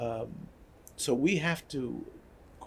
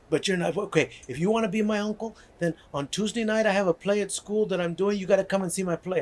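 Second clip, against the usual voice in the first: put-on higher, louder kid voice, pushing hard; quick eager boy demanding you come see his play; little boy insisting; 6 semitones higher, 5 dB louder than usual.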